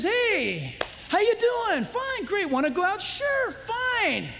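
high, bouncy flirty back-and-forth; mock pickup chat. Preacher acting out someone asking a date, high and flirty. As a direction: play both sides of a date invite, high.

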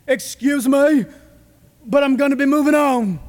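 loud, projected preacher excusing himself; mock public announcement. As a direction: announce your exit to a whole crowd. Acting preacher leaving mid-crusade.

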